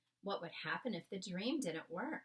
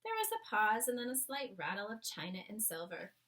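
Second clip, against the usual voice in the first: plain reading voice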